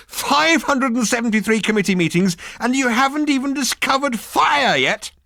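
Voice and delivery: high, loud disbelief; exasperated exclamation. Acted. Ford mocking the committee's failure, loud disbelief. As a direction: hammer home how absurd it is. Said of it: "he exclaimed".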